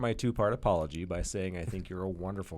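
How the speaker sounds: low, soft, grave mock apology; hushed, overly earnest public apology; mock solemn apology. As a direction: apologize to a nation with hammed-up sincerity. Mock formal apology to Canada.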